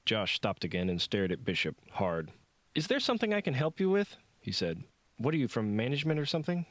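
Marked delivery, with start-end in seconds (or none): 2.7-4.2 high, fast, pointed; irritated. A narrator's reading. Irritated janitor confronts staring Bishop, high and fast. 5.2-6.7 sarcastic. A narrator's reading. Josh needling Bishop.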